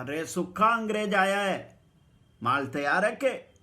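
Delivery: loud, high call to the son. Retold or imitated. Potter calling his son through the door, loud.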